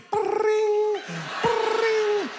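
high sung bring bring like a phone ringing. Retold or imitated. Imitating the ringing phone in his head, high. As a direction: mimic a phone bell, high. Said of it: "the phone starts ringing in my head".

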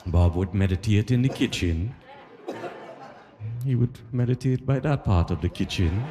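ordinary delivery

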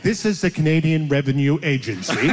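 flat robotic scam call voice. Retold or imitated. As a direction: robocall voice, laughing through it. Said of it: "robot voice saying".

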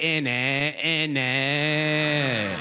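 sing-song, drawn-out fake British; mock British accent. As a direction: chant it in exaggerated British. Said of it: "You're like".

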